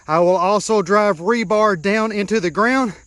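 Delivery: usual speaking voice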